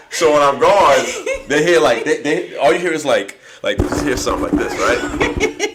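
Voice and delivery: loud and high, laughing throughout. Real, not acted. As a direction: crack up mid-sentence. Laughing while telling drunk story.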